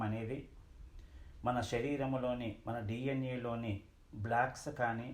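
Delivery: low, measured voice with long pauses; guided meditation. Acted. Teacher guiding seated friends into breath awareness, guided meditation. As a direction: settle them into stillness, pitch dropped.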